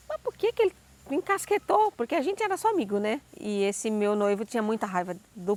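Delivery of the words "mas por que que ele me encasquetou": high, baffled wondering; mock bewildered thought; puzzled inner question. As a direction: ask yourself, puzzled and high. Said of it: "E aí eu olhava assim".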